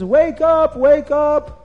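high, loud, urgent cries; Nimrod screaming. A narrator's reading. Nimrod to all the officers after his nightmare, screaming. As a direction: rouse everyone with a panicked yell. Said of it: "Nimrod is screaming to all the officers".

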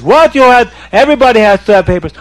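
high, loud and fast outrage; indignant official. Acted. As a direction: snap back in disbelief. Police chief outraged at no papers.

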